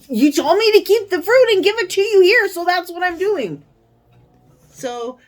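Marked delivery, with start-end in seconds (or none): 0.1-3.6 much higher and louder, indignant; exasperated protest. Retold or imitated. Telling customs she only followed their rules, high, loud and indignant. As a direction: push back hard in disbelief.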